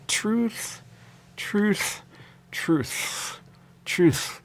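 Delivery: high strained squeak on each truth; mock-choking on truth; struggling to say it. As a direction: force the word out like it hurts. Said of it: "I can't even say it".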